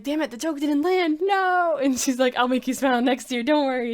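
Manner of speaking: high, loud energetic whine; excited frustration. Retold or imitated. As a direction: hyper kid cheering herself on. Emu's joke failing, voiced.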